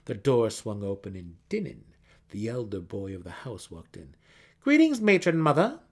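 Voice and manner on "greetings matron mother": high, loud courtly greeting; young son greeting his mother formally. A narrator's reading. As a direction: greet her with a proper bow in the voice. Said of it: "he said in an appropriate manner".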